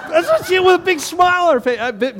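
high, loud words through real laughter; barely getting it out while cracking up; laughing hard. Real, not acted. Cracking up retelling church story.

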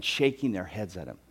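ordinary talk

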